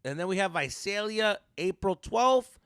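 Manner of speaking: loud, high, slow announcer read; tour announcement. Real, not acted. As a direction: announce the dates like a hype man.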